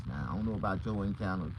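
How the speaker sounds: normal talking voice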